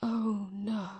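soft, hushed dismay; stricken grief. Acted. Miles learns his father died, hushed grief. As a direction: let it sink out softly.

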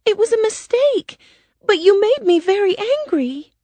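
put-on very high-pitched girl's excuse; apologetic girl. A narrator's reading. Dorothy explaining herself to the witch, high girlish protest. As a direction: plead your case as a young girl. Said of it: "Dorothy said".

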